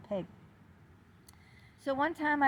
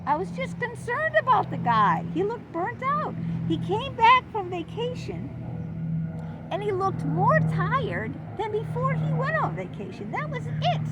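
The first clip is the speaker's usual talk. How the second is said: high, louder protesting; indignant self-defense; 11 semitones higher, 4 dB louder than usual. Real, not acted. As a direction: insist on your innocence.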